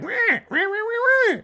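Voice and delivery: very high wordless squawking; cartoonish high-pitched nagging imitation; mock screeching woman. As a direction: mimic her as shrill "wah" noises. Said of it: "She's like".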